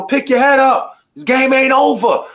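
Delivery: high-pitched rallying holler; rally squad cheerleader. Retold or imitated. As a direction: rouse the team in a high, urgent voice. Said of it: "the cheering squad".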